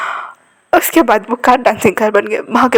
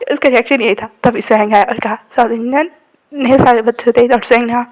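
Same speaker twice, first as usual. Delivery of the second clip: usual speaking voice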